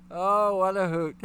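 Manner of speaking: slow, chuckling delivery; laughing. Real, not acted. Host still amused by the chat comment, chuckling. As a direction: draw it out while laughing. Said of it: "Reason why I'm laughing".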